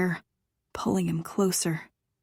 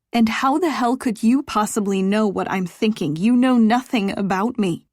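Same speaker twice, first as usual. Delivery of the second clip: louder, higher, furious comeback; angry retort; 4 semitones higher, 7 dB louder than usual. Acted. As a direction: bite back at him hard. She snaps back at him.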